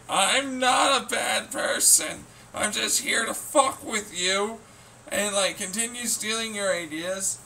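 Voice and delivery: high, slow, sugary fake-innocent voice; mock innocent voice. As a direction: play the sneaky agent acting sweet. Mimicking hackers justifying themselves.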